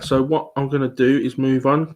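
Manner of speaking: ordinary delivery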